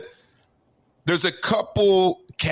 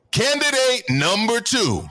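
game show host; 8 semitones higher than usual. Pretend. Announcing candidate like host.

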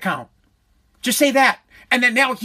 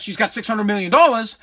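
ordinary talk

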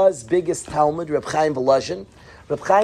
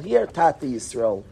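ordinary talk

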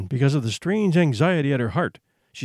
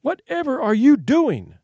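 high, louder alarmed cry; 9 semitones higher, 4 dB louder than usual. Acted. Mother crying out to her son in the lit room, alarmed. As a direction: demand it in fright, voice up high. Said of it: "she cried".

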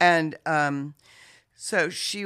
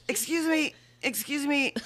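higher, timid excuse me; polite interruption; 8 semitones higher, 3 dB quieter than usual. Retold or imitated. Woman in labor politely interrupting the dancers, meek. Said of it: "And she goes".

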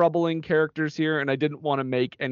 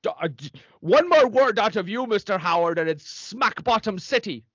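stern nun threat; 7 semitones higher than usual. Acted.

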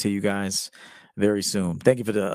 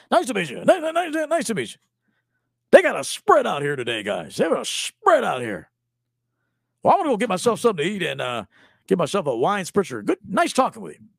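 higher, quick stammering pleasantries; hurried schmoozer; 7 semitones higher, faster than usual. Retold or imitated. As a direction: overeager guy working the room. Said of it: "that's how he talks".

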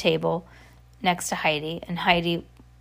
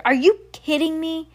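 higher, indignant question; glaring disbelief; 8 semitones higher than usual. A narrator's reading. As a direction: snap at a brother in disbelief. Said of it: "Heidi glared at her brother".

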